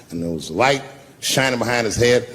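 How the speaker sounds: usual speaking voice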